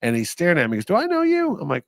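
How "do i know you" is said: much higher, squinting puzzled question; puzzled recognition. Retold or imitated. Lincoln staring at the host, high and puzzled. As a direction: ask it high, half recognizing him.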